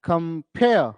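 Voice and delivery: "compare" split as com-PARE; light "com", then a drawn-out, falling "pare" in "compare"; stress on second syllable. Shown as a demonstration. Teacher repeating the word to mark its stress, on "pare" of "compare". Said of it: "stressed।".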